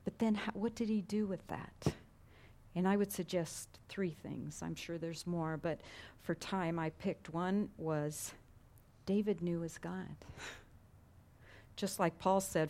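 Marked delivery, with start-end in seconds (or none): none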